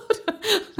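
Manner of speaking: a short, high, burst-out laugh; high bright laugh. Real, not acted. Laughing after telling the host about her mirror moment. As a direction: laugh at your own "oh my God" moment.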